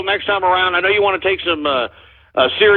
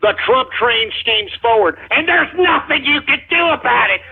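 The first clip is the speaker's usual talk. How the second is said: ordinary talk, nothing marked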